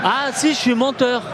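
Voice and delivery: laughing at himself. Real, not acted. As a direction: say it through a laugh. Laughing while admitting a fib.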